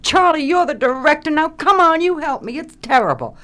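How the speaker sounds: high, loud insistent pleading; badgering the director for help; a younger actress begging her director to fix her scene; retold pleading.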